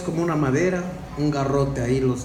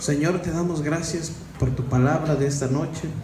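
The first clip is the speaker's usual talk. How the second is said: soft, low prayer voice; closing prayer; 3 semitones lower, 3 dB quieter than usual. Acted. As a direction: pray gently to the Lord.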